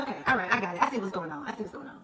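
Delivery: low, soft, fading to near-whisper; retold knowing aside. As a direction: say it under your breath, as if catching on. Retells own knowing reaction, trailing down.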